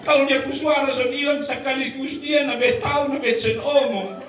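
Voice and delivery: high-pitched mock complaint to God; lamenting wife. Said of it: "Lalu ibu itu berkata begini".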